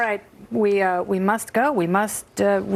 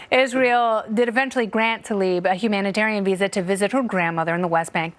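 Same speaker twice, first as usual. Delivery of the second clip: ordinary talk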